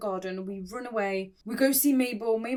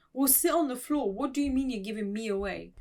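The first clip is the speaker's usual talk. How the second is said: ordinary talk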